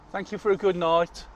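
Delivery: loud, high mock thank-you; mock farewell. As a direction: sing it out cheekily as a farewell.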